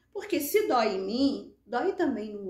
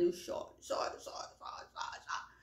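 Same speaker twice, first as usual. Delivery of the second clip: voiceless repeated murmur, dying away; hushed, whispered tailing-off; fading to whisper; 9 dB quieter than usual. Retold or imitated. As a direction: let the repetitions dissolve into breath. Acting out crying herself empty.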